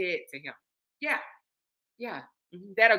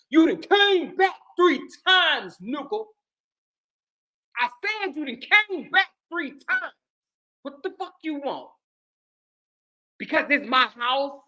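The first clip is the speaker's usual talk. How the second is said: mock angry wife. Wife yelling at husband who came back, mock angry. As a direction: yell it at him like the aggressor. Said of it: "let me say it how they, they think she said it".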